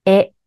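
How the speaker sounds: a distinct model "え", tongue lifted; clearly articulated vowel. Shown as a demonstration. Coach demonstrating vowel practice to learners, clearly articulated "え". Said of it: "あの口で下を持ち上げてください".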